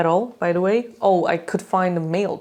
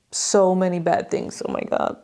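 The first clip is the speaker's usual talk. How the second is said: normal talking voice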